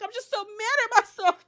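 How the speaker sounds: high, loud tearful imitation; hammed-up weepy self-reproach; mock emotional breakdown. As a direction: wail it like someone breaking down. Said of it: "she just gets so emotional".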